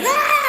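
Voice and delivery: high laughing fangirl squeal; overexcited fan squealing at her idol; excited squeal. Retold or imitated. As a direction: let out a giddy fan shriek.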